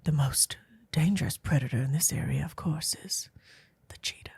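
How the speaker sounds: soft, whispered nature-documentary narration; Tom Hanks narrator. Retold or imitated. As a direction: hush it like a wildlife narrator. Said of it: "Tom Hanks in his velvety voice".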